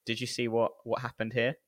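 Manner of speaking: normal talking voice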